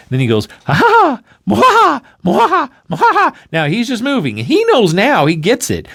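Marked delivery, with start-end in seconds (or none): 0.6-3.3 high, loud hammed-up villain cackle; mock evil laugh.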